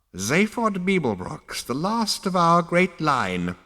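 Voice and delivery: a creaky ancestor drawing out the name; slow, high ancient ghost. A narrator's reading.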